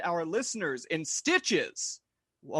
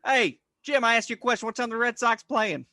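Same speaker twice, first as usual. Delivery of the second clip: hammed-up Boston accent, higher and louder; pushy Boston guy asking about the Red Sox; 5 semitones higher, 5 dB louder than usual. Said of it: "wicked cool Boston stereotypes".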